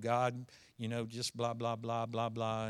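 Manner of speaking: low, soft mock mumble; imitated mumbled prayer. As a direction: run it together, low and quiet, like mumbled praying. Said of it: "he kinda mumbled".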